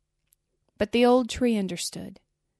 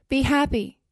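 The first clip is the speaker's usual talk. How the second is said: raised, louder joyful call; crying out; 5 semitones higher, 5 dB louder than usual. A narrator's reading. Nightingale comforting the weeping student, crying out. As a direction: call out encouragement, pitched up. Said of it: "cried the nightingale".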